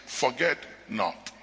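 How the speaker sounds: ordinary delivery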